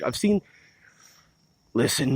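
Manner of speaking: usual speaking voice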